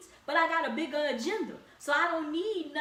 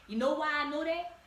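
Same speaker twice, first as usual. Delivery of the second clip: ordinary talk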